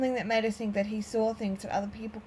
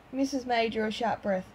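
usual speaking voice

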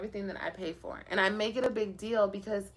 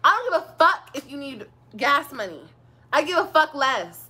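louder, higher mock-furious refusal; retold angry refusal; 4 semitones higher, 6 dB louder than usual. Retelling her refusal to the ex. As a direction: throw his request back at him. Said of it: "and I was like".

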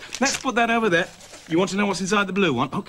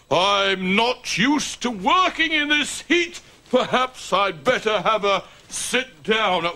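slow, hearty put-on old Santa voice; jolly and booming, stretching the words out; Father Christmas; 4 semitones higher, slower than usual. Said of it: "I'm pretending to be Father Christmas".

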